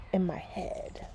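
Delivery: final poem line whispered; whispered ending. Acted. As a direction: let the last words fade into a whisper.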